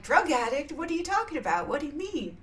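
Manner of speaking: high-pitched, bewildered protest; acted incredulous questioning; mock indignant disbelief. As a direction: play an accused bear, baffled and offended. Bear protests the accusation.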